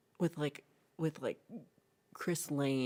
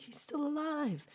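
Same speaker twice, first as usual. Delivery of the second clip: high, slowed amazed exclamation; surprised discovery; 12 semitones higher, slower than usual. Retold or imitated. Rescuers finding the captain, a high amazed cry. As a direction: gasp it out high and slow.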